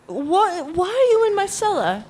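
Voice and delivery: high indignant shriek of surprise; shocked outrage. Acted. Housewife finds strangers in cellar.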